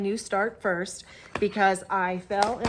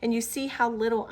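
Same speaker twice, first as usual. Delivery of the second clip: ordinary talk, nothing marked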